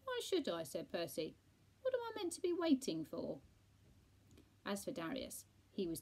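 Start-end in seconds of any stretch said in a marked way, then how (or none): 0.0-0.6 high, loud retort; pitched-up huffy question; indignant deaf friend. A narrator's reading. Deaf Percy objects. 1.8-3.4 loud, high-pitched grumble; pitched-up huffy question; confused deaf friend. A narrator's reading. Deaf Percy, still confused.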